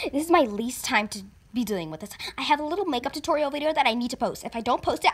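ordinary delivery

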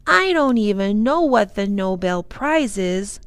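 put-on mildly puzzled child; bewildered. A narrator's reading. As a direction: say it as if mildly baffled. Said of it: "Lindy sounded bewildered".

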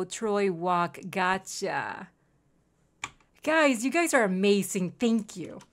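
usual speaking voice

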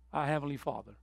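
ordinary talk

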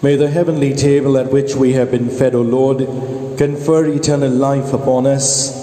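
ordinary talk, nothing marked

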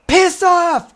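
very high, loud dismissive yell; angry gnome inventor. Pretend. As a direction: shout them away in a high gnome voice. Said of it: "she's like".

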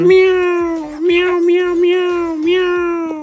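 very high, thin cat meows; high meowing kitten. Acted. Baby in the nursery crying like a little cat.

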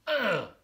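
loud, high groan of disgust; disgusted ugh. Acted. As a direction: recoil from slimy seaweed. Cub rejects seaweed.

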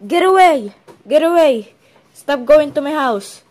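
loud, forceful shouts; alarmed shouting. Acted. Yelling at clown outside the house, alarmed. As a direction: shout to drive an intruder off.

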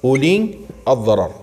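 unhurried Quranic recital, line by line; Quran recitation. Shown as a demonstration. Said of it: "الله عز وجل يقول في القرآن الكريم".

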